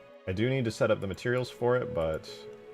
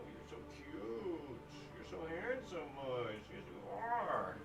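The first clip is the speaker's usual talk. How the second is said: pet-talk cooing; 7 semitones higher, 11 dB quieter than usual. Real, not acted. Streamer greeting Mush at the door, cooing pet talk. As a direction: coo softly and sweetly over a beloved pet.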